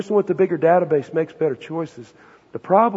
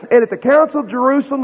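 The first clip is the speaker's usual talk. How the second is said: ordinary talk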